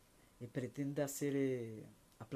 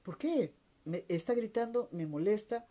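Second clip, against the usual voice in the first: annoyed outburst; 6 semitones higher, 5 dB louder than usual. Retold or imitated. Annoyed inner rant while being yelled at.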